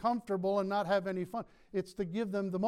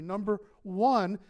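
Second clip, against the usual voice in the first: ordinary talk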